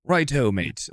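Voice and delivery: light, hammed-up British righto; a jokey fake British accent; mock British accent. Showing his friend how a British accent sounds cool, in mock British accent. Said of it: "British or Australian accent".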